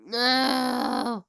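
loud, high cry of no; yelling in real dismay; real cracked shout; frustrated shout. Real, not acted. Voice cracks, cries out.

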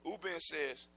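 ordinary delivery, nothing marked

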